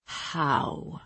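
low, hushed, raspy question; stunned, low and quiet. A narrator's reading. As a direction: drop to a soft, husky rasp. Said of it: "his voice low and raspy".

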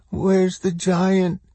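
put-on slow, high, dazed child voice; little girl. A narrator's reading. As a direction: ask slowly as a weak small child. Dazed little girl asks again.

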